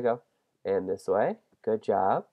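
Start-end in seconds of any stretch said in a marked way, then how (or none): none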